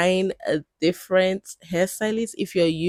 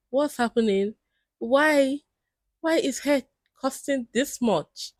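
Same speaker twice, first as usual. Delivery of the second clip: higher-pitched, baffled complaint; exasperated disbelief; 7 semitones higher than usual. Acted. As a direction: plead to know why, pitched up. Acts out baffled reaction to prices.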